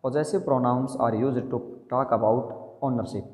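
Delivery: slow dictation. Shown as a demonstration. Dictating the definition slowly.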